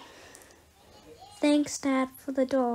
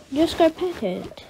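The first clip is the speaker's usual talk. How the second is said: normal talking voice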